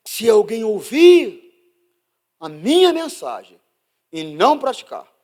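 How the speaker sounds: slow, loud, lifted scripture read; booming slow Bible reading. Acted. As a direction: proclaim the verse slowly.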